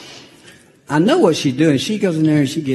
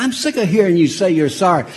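louder, higher, fast hammed-up scold; mock angry scolding; 10 semitones higher, 8 dB louder, faster than usual. Grandfather scolding the grandson, hammed-up angry scold. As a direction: play the fed-up grandpa, half laughing.

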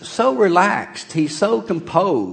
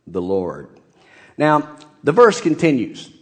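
usual speaking voice

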